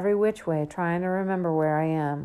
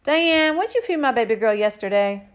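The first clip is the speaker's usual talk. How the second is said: loud, raised fatherly yell; 6 semitones higher, 6 dB louder than usual. A narrator's reading. Father questioning babysitter from upstairs, yelling. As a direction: yell it from the top of the stairs. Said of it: "and yells".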